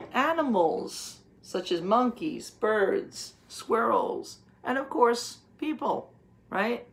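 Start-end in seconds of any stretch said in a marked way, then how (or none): none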